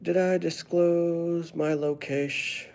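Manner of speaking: high, slow, drawn-out questioning; mock suspicion. Streamer answering a nosy viewer, exaggerated slow questioning. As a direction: stretch "disclose" out, pitched high.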